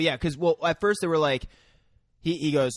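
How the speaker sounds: normal talking voice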